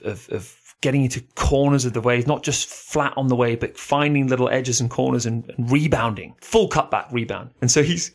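usual speaking voice

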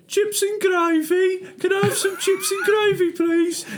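high, drawn-out Birmingham caricature; mock Birmingham accent. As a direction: tease with a squeaky Brummie accent. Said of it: "We don't talk like that".

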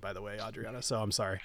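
usual speaking voice

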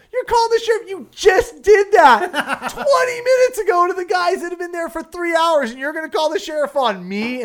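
high, full-blast indignant tirade easing at the end; outraged yelling. Retold or imitated. Guide yelling at the boater who threatened him, outraged. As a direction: yell it back in sheer disbelief. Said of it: "I'm yelling at the guy".

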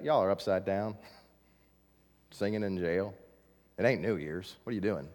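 jailer voice. Retold or imitated. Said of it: "the jailer's like".

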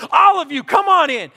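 high, loud call across the field; coach calling out. Retold or imitated. Angry coach calling his infield to the mound, shouting. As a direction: summon the team like an annoyed coach.

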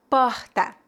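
Porta with a guttural strong R, /pɔhtɐ/; Portuguese: porta com R forte. Shown as a demonstration. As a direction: say Porta with a throaty h-like R. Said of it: "R forte".

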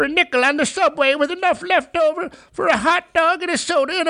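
put-on high, cranky old-timer voice; old prospector. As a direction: grumble like a crusty old codger. Grumbling about "back in my day.

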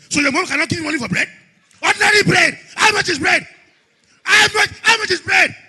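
angry father. Acted. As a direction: lose patience with the child over bread money. Father scolding child over bread money.

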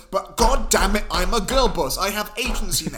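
put-on loud, punchy girl-boss declaration; mock defiant outburst. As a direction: hammer out each word in mock indignation. Acting out Alice's defiant pitch.

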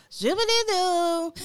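high, slow Sims-style gibberish. Retold or imitated. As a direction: chirp it like a cartoon Sim. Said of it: "they would complain, like".

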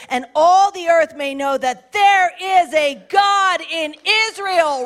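slow, loud, declaring it over the giant; shouted battle cry. Acted. David shouting at Goliath, a slow loud battle cry. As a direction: roar the words at the enemy.

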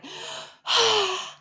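high, long imitated dog sigh; imitating a bored dog's sigh. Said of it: "let out a long sigh".

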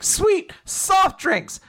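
very high, thrilled squeal of thanks; delighted. Retold or imitated. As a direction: play-act delight in a high-pitched voice. Retold delight at being handed drinks.

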